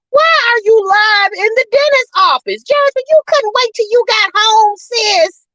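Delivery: high-pitched incredulous rebuke; worked-up, high exclamation of disbelief; exasperated scolding. Retold or imitated. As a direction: scold her like you can't believe it. Acting out scolding Jasmine for going live.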